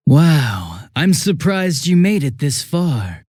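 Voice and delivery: ordinary delivery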